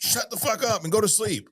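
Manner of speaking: high, heated mock yell; angry retort. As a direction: bark it like you're back at the pool. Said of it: "I was angry".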